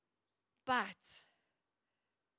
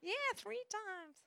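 a lighter, slow South Carolina drawl, higher; Southern US accent; 10 semitones higher, slower than usual. Retold or imitated. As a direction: redo the South Carolina lady's line gently. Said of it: "that was bad accent".

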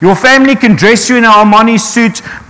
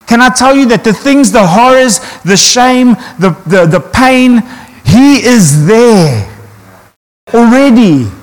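building preaching; 4 semitones higher than usual. Acted. Preacher to congregation about facing horrors, rising fervour. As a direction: lift the crowd then land it slowly.

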